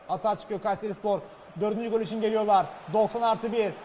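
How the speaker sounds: ordinary talk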